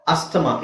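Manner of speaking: ಅಸ್ತಮ with S and T sounded; common pronunciation. Shown as a demonstration. Teacher quoting the common form, ಅಸ್ತಮ with ST voiced. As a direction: say ಅಸ್ತಮ in three syllables, as-ta-ma. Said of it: "ಅಸ್ತಮ ಅಂತ ಹೇಳ್ತಾರೆ common ಆಗಿ".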